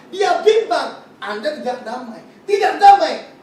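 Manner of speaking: loud, punching preacher's call; emphatic shout. Real, not acted. Preacher telling doubters they have no peace, shouting. Preacher driving home point.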